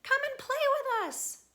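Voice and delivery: high, loud friendly call. Acted. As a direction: shout an invitation to play. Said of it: "they would call".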